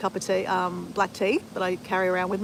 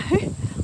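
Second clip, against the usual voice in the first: short unvoiced laugh; breathy laugh; 10 semitones higher than usual. Real, not acted. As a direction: let a quick airy laugh out. Laughs after imitating dad.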